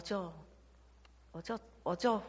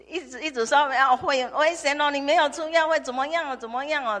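high, loud imitation of people nagging; mock alarmed warning; 8 semitones higher, 9 dB louder than usual. Imitating friends who warn her about pills, high and loud.

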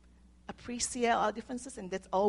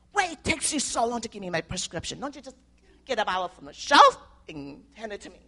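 mock angry customer, louder at the end; impatient customer yelling; 8 dB louder than usual. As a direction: act out a rude customer's impatient yell. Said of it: "And he's yelling".

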